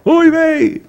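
high, hammed-up Yiddish accent yell. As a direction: cry out oy vey with a Yiddish accent.

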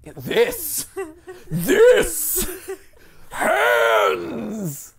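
high dramatic yell; shout. Pretend. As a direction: shout it triumphantly. Shouting at hands.

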